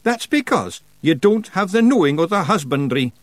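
high, crowing Scottish voice; triumphant. A narrator's reading. Rob tells Feegles they lack husbandry knowledge, triumphant. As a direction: crow over winning the point. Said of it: "said Rob Anybody triumphantly".

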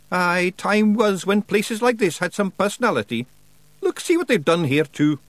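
high, broad Scots wee man, cheeky and gruff; Scottish pictsie Rob. Acted. Pictsie chief thanking nobody for thanks, in a high Scots voice.

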